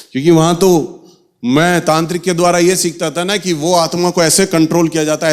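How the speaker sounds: normal talking voice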